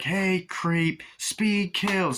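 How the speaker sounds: taunting a driver, lightly; mock yell. [name] yelling at the creep in the car, high mock taunt.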